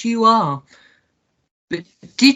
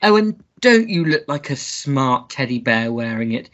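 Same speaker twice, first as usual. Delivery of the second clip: ordinary delivery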